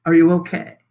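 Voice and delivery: ordinary delivery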